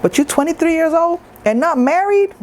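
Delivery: mock outraged relative, high; hammed-up disbelieving auntie; scandalized auntie. Mocking relatives' marriage pressure.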